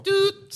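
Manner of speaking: high, loud imitated siren toot; siren hoot. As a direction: mimic the game's siren blast.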